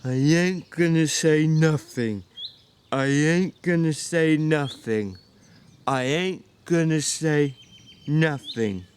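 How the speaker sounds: slow, repetitive murmured drone; Lenny memorising his line under his breath; droning to himself. Acted. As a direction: repeat it over and over to yourself like a lesson. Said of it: "Lenny droned to himself softly".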